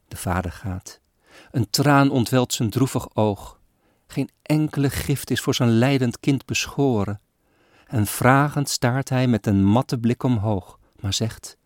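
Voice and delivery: measured, solemn poem reading; poem recitation. A narrator's reading. Reader recites a sad Christmas poem, solemn and measured. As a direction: recite the old verses with gravity.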